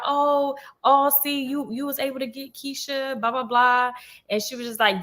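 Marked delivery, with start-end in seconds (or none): 0.0-3.9 higher teasing taunt, lightly voiced; mocking teasing teammates. Teammates teasing her friend, a higher mock taunt. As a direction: taunt her like the gossiping girls. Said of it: "So everybody was like".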